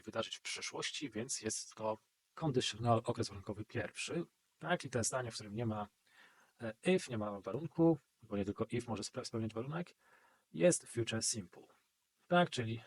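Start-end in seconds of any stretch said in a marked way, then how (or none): none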